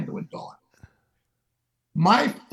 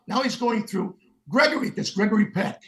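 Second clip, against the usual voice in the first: ordinary delivery, nothing marked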